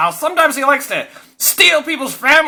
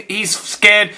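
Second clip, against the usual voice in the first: ordinary talk, nothing marked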